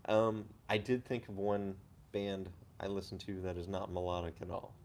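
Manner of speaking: ordinary talk, nothing marked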